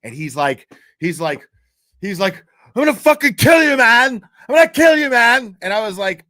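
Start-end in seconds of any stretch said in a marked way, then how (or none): 2.7-5.6 loud, high, furious yelling; angry threat. Retold or imitated. Jilted man bursting in on his rival, furious yelling. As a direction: scream the threat like an enraged intruder. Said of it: "this guy was fucking angry".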